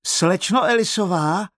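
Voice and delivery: high, loud call across the bush. Acted. Carmen searching the bush calls out for Eliza. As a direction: call her name from a distance. Girl calling through the bush.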